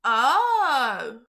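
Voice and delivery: ordinary delivery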